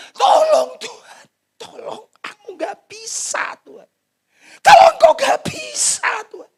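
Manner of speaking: high, drawn-out begging to God; slow, high-pitched tearful cry for help; tearful pleading prayer. Acted. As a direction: plead desperately as if weeping. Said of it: "Sambil nangis berjam-jam".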